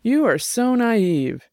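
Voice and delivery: derisive. Acted. Jenny mocking Max's naivety, slow and derisive. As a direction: drag it out with scorn. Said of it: "Jenny said derisively".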